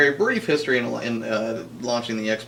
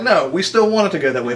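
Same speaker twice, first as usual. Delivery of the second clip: mock company voice; 6 semitones higher, 7 dB louder than usual. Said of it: "they're just like".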